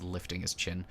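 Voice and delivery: normal talking voice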